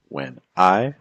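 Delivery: "when I" with "when" light and "I" stressed; weak-strong stress. Shown as a demonstration. Teacher scanning a sonnet line for students, weak-strong stress. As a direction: lean on "I" after a light "when". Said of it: "it's like weak stress".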